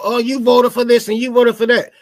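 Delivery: whiny mock bickering; mocking complainers. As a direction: sneer out the bickering. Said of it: "everybody bitching about".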